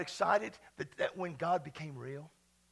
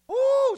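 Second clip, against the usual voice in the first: loud, high "woo" holler; joyful whoop; 14 dB louder than usual. Real, not acted. Whoops between points.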